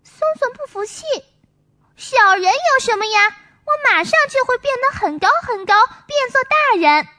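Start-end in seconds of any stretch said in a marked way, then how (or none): 2.0-7.1 put-on higher, quicker little boy protesting; indignant child. Songsong answers Maomao's teasing, indignant child voice. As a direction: push back like an offended kid.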